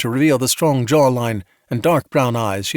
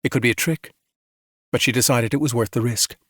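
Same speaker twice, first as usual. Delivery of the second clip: usual speaking voice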